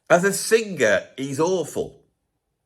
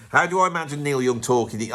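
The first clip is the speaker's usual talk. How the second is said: usual speaking voice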